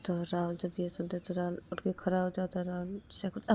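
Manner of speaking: ordinary delivery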